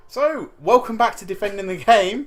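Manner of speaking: show host intro. Acted.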